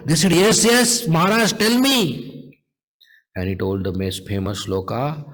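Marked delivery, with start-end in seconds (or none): 0.3-2.0 high, excited retold urging; eager pleading. Sannyasis want to hear more, eagerly urging. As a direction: urge him on, pitched high. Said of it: "they wanted to hear more".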